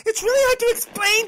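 high, fast, loud strained rush; strained agitation. Acted. Transforming patient straining to explain herself to the doctor, high and rushed. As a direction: blurt it out, overwhelmed.

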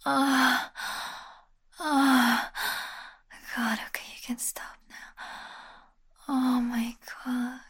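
normal talking voice

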